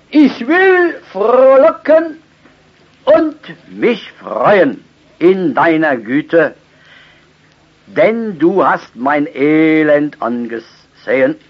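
high, loud, slow psalm proclamation; preaching at full force. Acted. Preacher proclaiming the psalm to his congregation, loud and slow. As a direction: cry the verse out to the congregation.

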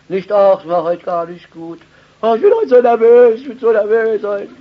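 high, loud, fast whiny complaint; mocking a fretful churchgoer. Preacher mocking a nervous believer's whining, high and fast. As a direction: whine like someone who lets feelings rule. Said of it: "so machen es doch die meisten".

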